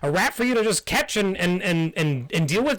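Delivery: high, fast, squeaky indignant rant; squeaky outraged bat. Acted. GM voices trapped bat.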